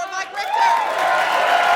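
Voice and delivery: slow, drawn-out booming name call; arena announcer. Acted. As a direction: stretch the name like a ring announcer. Host introduces second guest.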